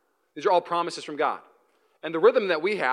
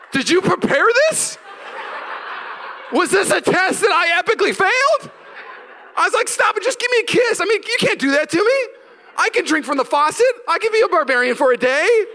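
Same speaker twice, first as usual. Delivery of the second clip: much higher, louder, laughing squeals; laughing embarrassment; 14 semitones higher, 6 dB louder than usual. Retold or imitated. Husband caught out by wife's test, high laughing squeal. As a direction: laugh through the shame.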